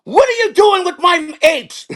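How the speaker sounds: high, drawn-out outraged director; very high, slow comic scolding; Tim Burton. Acted. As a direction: screech it in mock outrage at the culprit. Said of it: "uh, Tim Burton, goes".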